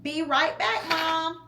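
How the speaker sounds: put-on boy shouting goodbye over his shoulder. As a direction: call it out while running off. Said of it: "Theo shouted".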